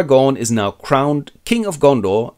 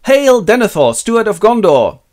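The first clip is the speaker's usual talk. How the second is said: higher and louder, ceremonious announcement; mock herald call; 10 semitones higher, 7 dB louder than usual. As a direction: proclaim it like a pompous herald. Herald greeting the steward.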